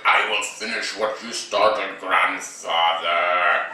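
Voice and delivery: lightly low villain voice; solemn Kylo Ren. Retold or imitated. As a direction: vow it darkly to the helmet. Kid voices Kylo Ren to helmet.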